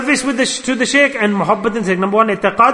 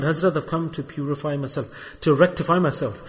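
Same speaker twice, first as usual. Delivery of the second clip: soft, lowered and humble; humble hush; 5 semitones lower, 7 dB quieter than usual. Acted. Disciple quietly addressing his shaykh, humble and soft. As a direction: speak meekly to a master.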